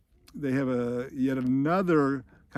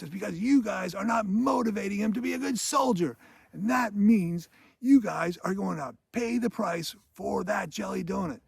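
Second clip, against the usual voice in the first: mock screamed tirade; 7 semitones higher than usual. Sergeant screaming at all the cadets, mock yelled tirade. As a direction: imitate a sergeant berating the whole squad. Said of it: "screaming at all these guys".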